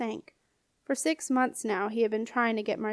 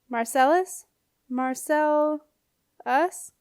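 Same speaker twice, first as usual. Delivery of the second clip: raised, higher call up the stairs; 5 semitones higher, 4 dB louder, slower than usual. A narrator's reading. Apprentice calls up the stairs to the alchemist, calling out. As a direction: call his name up into the dark house. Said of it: "he called out".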